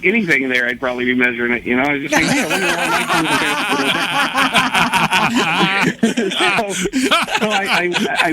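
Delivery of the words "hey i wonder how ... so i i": laughing through the words; chuckling while saying it. Retold or imitated. Retold inner thought, laughing.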